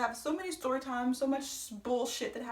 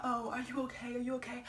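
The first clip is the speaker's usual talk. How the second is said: softer imitation of someone checking in; retold concern; 6 dB quieter than usual. Ronald coming up to the car, softly imitated concern. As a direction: quietly mimic his worried question. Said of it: "coming up to the car talking about".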